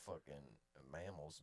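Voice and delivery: ordinary talk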